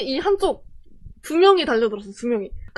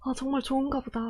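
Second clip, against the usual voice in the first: subdued; 10 dB quieter, faster than usual. Retold or imitated. Watching the winner, deflated.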